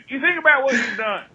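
ordinary talk

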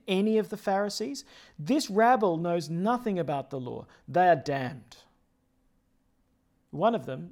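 formal lector reading; gospel reading. Acted. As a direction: proclaim the Gospel. Proclaims the Gospel.

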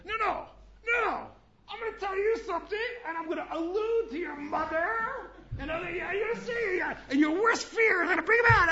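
high, loud, hammed-up rant breaking into laughs; mock angry tirade. Teacher parodies an angry man insulting someone's mother, high laughing shout. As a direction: shriek the insults like a lost-it hothead.

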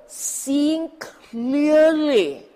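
seeing clearly drawn out slow, high and loud; emphasized phrase. Shown as a demonstration. Teacher stressing a key phrase to the audience, slow and loud. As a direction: lean hard on each word of seeing clearly. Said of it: "note the word".

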